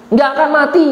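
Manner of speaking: high-pitched, emphatic tempter's pitch; insistent devil persuading. Acted. Devil convincing Eve of God's reason for forbidding, insistent. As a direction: push it high and urgent, desperate to convince. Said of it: "iblis mencoba meyakinkan itu".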